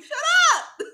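high, loud laughing yell; embarrassed friend yelling at laughing pal, mock-loud; mock shout. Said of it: "I'm like".

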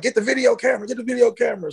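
high, hurried call to grab the camera; urgent call. Retold or imitated. Calling to his friends to film the trapped bird, high and urgent. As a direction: pitch it up high, urgent and excited.

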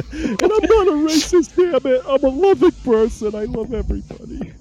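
high, hammed-up protesting; mock defensive. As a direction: overdo the innocent denial. Defensive joke about not racist.

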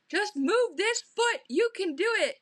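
high, loud, drawn-out urging; exasperated coaching. Retold or imitated. Cheer coach urging a friend through a dance step, high-pitched coaching. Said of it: "and she's like".

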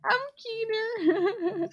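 high, soft, drawn-out giggly words; giggling. Real, not acted. Friend repeating own silly mistake, giggling helplessly. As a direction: squeak it out slowly through leftover giggles.

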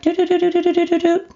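very high, rapid monotone "do-do-do" beeping; imitated homing signal. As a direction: mimic a target-lock alarm on one high pitch. Imitates kids homing in on weakness.

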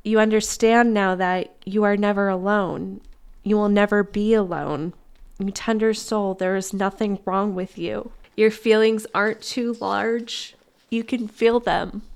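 gentle, reassuring read of a letter; reading a tender letter. Acted. Reading love's reply to her tired self, gently reassuring. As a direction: read it like love comforting you.

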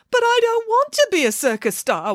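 put-on very high childish wail. Caged puppet refuses to be a star, wailing. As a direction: whine it out in high-pitched misery. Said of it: "wailed Bramwellio".

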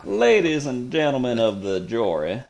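a touch of a Southern gentleman lawyer addressing the jury; lightly put-on Georgia lawyer opening; old Southern lawyer. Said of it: "like a old timey lawyer in a movie".

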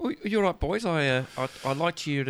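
badly faked Kiwi, halting; a put-on accent slipping off course; wandering New Zealand accent. Peter Jackson greeting two callers, wandering Kiwi accent. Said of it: "I've gone off the reservation here".

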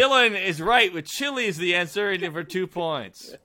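excited announcement. Real, not acted. Host announces the winner.

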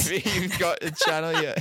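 breaking into giggles; can't hold back a laugh. Real, not acted. Laughing attempt at advice.

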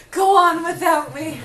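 dramatic dying plea, slow; overacted death scene. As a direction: ham up a hero's last words. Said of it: "So your scout has just given up".

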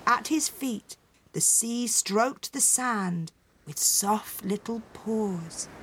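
ordinary delivery

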